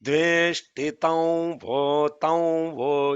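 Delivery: "Dveshtitam vo" slow and clear; correct syllable demo. Shown as a demonstration. Teacher shows the correct ending, slow and clear. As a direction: stretch "tam vo" clearly. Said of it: "You are saying tau".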